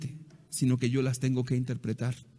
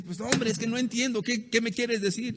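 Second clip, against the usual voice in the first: higher, exasperated mock plea; exasperated husband; 7 semitones higher than usual. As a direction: play the husband at his wits' end, pitched up. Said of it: "el hombre se desespera y dice".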